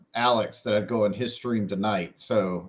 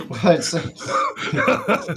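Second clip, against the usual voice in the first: slow words through laughter; laughing; 5 semitones higher, much slower than usual. Real, not acted. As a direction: stretch it out while laughing. Laughing at dark joke.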